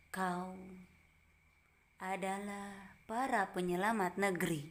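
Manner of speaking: "Kau" then a long pause before "adalah para penyelamat negeri", said slowly; pause demo. Shown as a demonstration. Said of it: "Coba cek".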